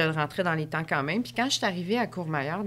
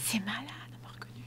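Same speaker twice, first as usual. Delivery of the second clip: fast, hushed whisper of disbelief; awed whisper; 4 semitones higher, 11 dB quieter, faster than usual. Real, not acted. Tells her friend she was recognized, in a fast whisper. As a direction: whisper it, still amazed.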